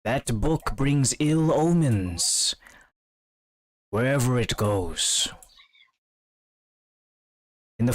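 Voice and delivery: lightly voiced menacing necromancer; menacing villain. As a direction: threaten them from afar.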